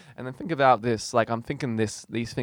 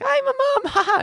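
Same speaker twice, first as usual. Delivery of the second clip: cheery mum; 5 dB louder than usual. Retold or imitated. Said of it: "She's like".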